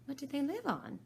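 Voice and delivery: put-on curious girl; Alice voice. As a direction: ask eagerly as Alice.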